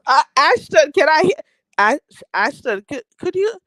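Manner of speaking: loud, high call for attention; a loud eager call to a viewer. Real, not acted. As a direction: call out to get her attention. Calling out to a viewer.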